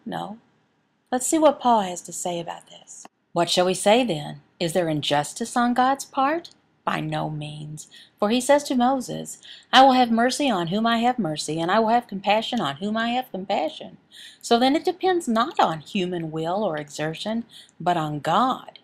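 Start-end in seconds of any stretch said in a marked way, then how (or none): none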